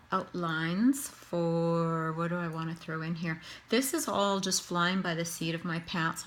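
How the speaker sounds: ordinary talk, nothing marked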